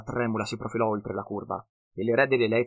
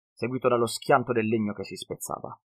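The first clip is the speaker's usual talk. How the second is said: usual speaking voice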